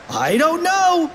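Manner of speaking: put-on slow high puzzled kid voice. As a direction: say it high and drawn out. Character puzzled.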